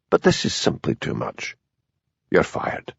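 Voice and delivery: soft, near-whispered dismissal; quiet fury. A narrator's reading. Superintendent firing Hamish, hushed cold fury. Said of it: "in the quiet voice he used when he was really furious".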